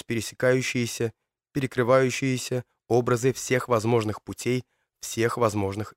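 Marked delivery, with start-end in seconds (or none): none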